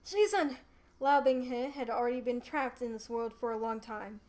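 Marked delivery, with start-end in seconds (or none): 0.1-0.6 higher, louder eager cry; happy shout to his master; excited call. Acted.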